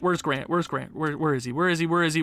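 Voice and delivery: ordinary talk, nothing marked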